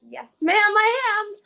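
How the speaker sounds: high, loud, drawn-out politeness; mock-obliging. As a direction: play up the eager compliance, slowly. Acted reply to exasperated examiner.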